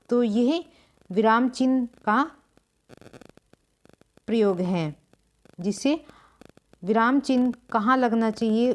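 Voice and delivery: normal talking voice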